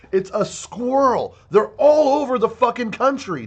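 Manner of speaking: real frustrated outburst at bureaucrats; exasperated anger. Real, not acted. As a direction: spell it out to the idiots. Ranting at department officials.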